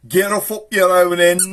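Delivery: high, loud mock neighbourly yell; cross grown-up shout. Grown-up clearing kids off the street, loud cross shout. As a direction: shoo the kids off at full volume. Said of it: "one of the grown-ups would come out".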